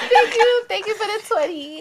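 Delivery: high laughing gush; gushing thanks. Pretend. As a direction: squeal out thanks for the tip.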